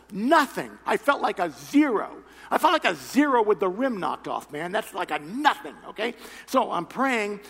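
ordinary talk, nothing marked

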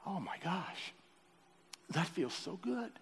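choked-up gratitude rising in pitch; weeping prayer. Retold or imitated. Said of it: "I'm already kinda weeping".

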